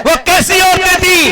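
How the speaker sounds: high, sustained rhetorical call; ringing high oratorical question; preacher's cry. Real, not acted. Calls the crowd back to preaching.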